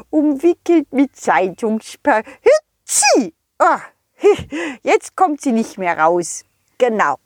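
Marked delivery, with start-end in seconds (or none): 2.4-3.3 squeaky high sneeze, drawn out. Acted. Snowman sneezes. (hohes, langgezogenes Niesen)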